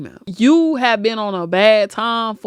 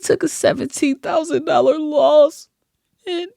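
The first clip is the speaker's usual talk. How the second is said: hammed-up fake emotional gasp, higher pitch; mocking her tears; 7 semitones higher than usual. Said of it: "nominated for an Oscar".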